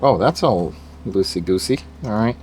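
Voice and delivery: ordinary delivery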